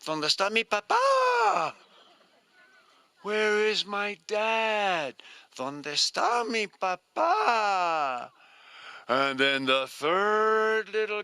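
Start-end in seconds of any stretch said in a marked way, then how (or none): 0.0-1.7 high, loud chick calling out; little chick crying out for her dad; calling baby bird. Acted. Baby bird calls for father. 3.2-8.3 put-on baby bird. Baby bird calls for father.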